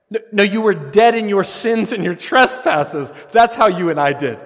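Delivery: loud, high preaching climax; preaching at full force. Acted. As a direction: thunder it out to the congregation. Peak of the sermon.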